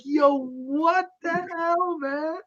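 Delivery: high, slow "yo, what the hell"; mock exasperation. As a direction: stretch it out high and slow, disbelieving. Reacting to the cleaver scene.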